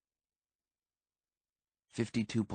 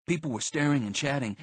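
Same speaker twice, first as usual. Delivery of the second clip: usual speaking voice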